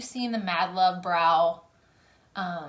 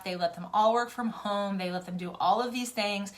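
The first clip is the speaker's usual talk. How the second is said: usual speaking voice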